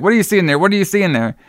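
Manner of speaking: high-pitched retold taunt; mock taunting demand. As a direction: pitch it up, acting out the bully. Retold bullying at the toilet.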